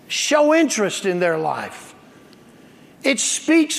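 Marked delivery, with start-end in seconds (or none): none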